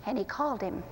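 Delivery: normal talking voice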